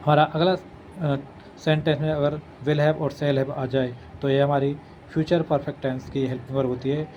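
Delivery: ordinary talk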